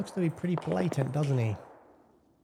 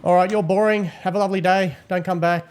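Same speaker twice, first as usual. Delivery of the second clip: loud dismissal; 5 semitones higher, 7 dB louder than usual. Acted. Admin dismissing the cheater.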